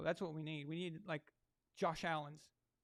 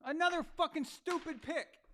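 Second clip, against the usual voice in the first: much higher and louder, slow, fed up; furious shout; 11 semitones higher, 5 dB louder than usual. Real, not acted. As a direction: yell at the screen over the pick. Angry at a bad play.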